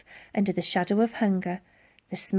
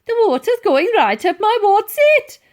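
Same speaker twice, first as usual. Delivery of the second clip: high loud panicky cry; soaked boy shrieking in panic; panicked squeal; 12 semitones higher, 10 dB louder than usual. Acted. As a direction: yelp it in alarm. Titch panicking, propelled upward.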